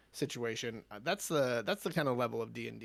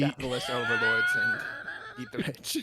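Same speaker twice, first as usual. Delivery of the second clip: laughing mock rally cry; 2 semitones higher, 6 dB louder than usual. Joking rant about inspiring revolution.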